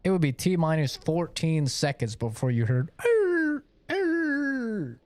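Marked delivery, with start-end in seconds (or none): 3.0-5.0 high yelping dog cries. Retold or imitated. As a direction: yelp like a hurt puppy.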